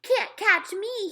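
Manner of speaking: put-on high, slow, loud teasing toddler holler; a little boy yelling as he runs off. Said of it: "he hollered".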